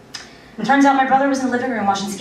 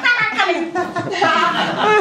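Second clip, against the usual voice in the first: high imitation duck quacking; cute duck quacks; 9 semitones higher, much slower than usual. Comic imagining a cute little duck in there, quacking. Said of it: "cute little duck".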